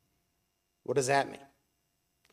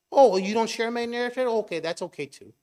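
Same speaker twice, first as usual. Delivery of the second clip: mock shrug; 7 semitones higher, 4 dB louder, faster than usual. Preacher mimics a relativist shrugging off disagreement.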